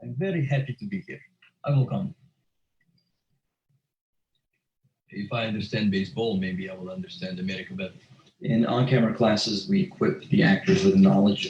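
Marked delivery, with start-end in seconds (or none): 0.0-1.2 a Russian accent, pitched higher. Shown as a demonstration. Actor demoing accents, now switching to a Russian accent. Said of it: "But the Russian accent". 1.6-7.9 Russian accent. Shown as a demonstration. Continues Russian accent demo.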